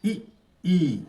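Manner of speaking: quick इ, drawn-out ई; short-long vowel drill. Shown as a demonstration. Teacher models the vowel pair, short इ then long ई. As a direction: say इ briefly, then hold ई.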